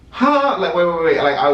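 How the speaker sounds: high-pitched startled "huh?"; confused. Retold or imitated. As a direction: blurt it out in sudden bewilderment. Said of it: "I'm just so confused".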